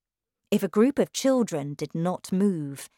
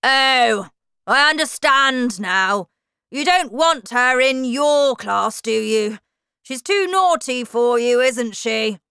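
loud, higher, mocking accusation; sneering shout; 7 semitones higher, 9 dB louder than usual. A narrator's reading. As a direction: taunt the teacher with a bully's bark. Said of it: "shouted Miss Trunchbull".

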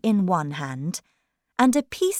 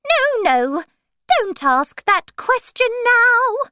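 high, loud, urgent objection; 6 dB louder than usual. Acted. Rabbit stopping the king's question, high urgent voice.

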